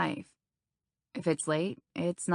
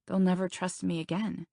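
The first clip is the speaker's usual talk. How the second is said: plain reading voice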